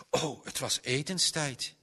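ordinary delivery, nothing marked

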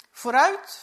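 ordinary delivery

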